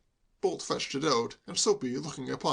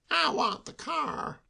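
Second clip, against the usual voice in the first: Soapy's voice; 14 semitones higher, slower than usual. A narrator's reading. Desperate Soapy demands the car.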